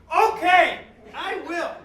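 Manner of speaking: high, loud mock surrender to temptation; eager giving in. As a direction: cave in with hammed-up delight. Acted shopper giving in to offer.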